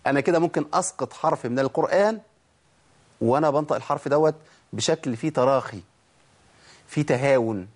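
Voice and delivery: ordinary talk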